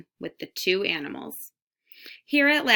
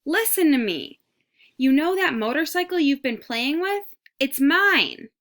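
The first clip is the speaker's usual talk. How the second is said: high, loud mouse shout; as loud as he could; 8 semitones higher, 6 dB louder than usual. A narrator's reading. Mouse shouts to Garf about his motorcycle, high and loud. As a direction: shout up from a tiny cage to be heard. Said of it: "said as loud as he could".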